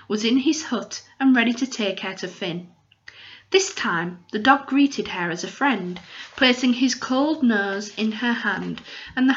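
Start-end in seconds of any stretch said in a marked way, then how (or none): none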